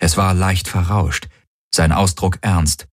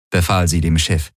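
plain reading voice

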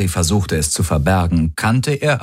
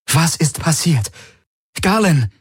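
higher, urgent worried question; demanding and worried; 9 semitones higher than usual. Acted. Ylana demanding news from Garlin, deeply worried. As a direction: press him anxiously. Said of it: "Ihre Stimme war fordernd und besorgt".

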